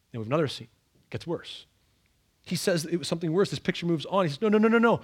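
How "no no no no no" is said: higher, louder string of no's; urgent pleading. Retold or imitated. Obadiah calling out to Edom, urgent raised plea. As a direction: cry out to stop them.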